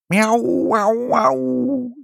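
very high, long-drawn meowing; grateful cat meows. A narrator's reading. Cats thanking mother, high drawn-out meows. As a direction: wail out sustained high meows. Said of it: "riepen de katten dankbaar".

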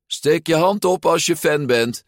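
high, loud command; addressing a crowd. A narrator's reading. Ercole demands hands from his fans, addressing a crowd. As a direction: project it to the whole square.

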